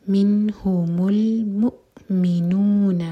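منہم المؤمنون drawn out slowly, the hamza cut off sharply; slow recitation. Shown as a demonstration. Teacher showing the hamza cut-off, slow recitation. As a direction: recite it slowly with a clean stop. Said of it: "cut off sound آئے گا".

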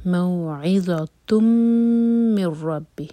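slow موعظۃ من ربہ, nasal میم, no نون sound; drawn-out recitation; tajweed recitation. Shown as a demonstration. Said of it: "میم پر غنہ".

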